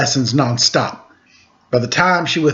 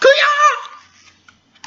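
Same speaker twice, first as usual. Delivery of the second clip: karate yell with laugh. Acted. Pig two showing off a punch with a laughing kiai. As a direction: shriek it like a martial arts kiai.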